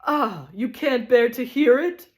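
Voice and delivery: triumphant wail of misery; shouted with miserable triumph. Acted. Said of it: "he shouted with miserable triumph".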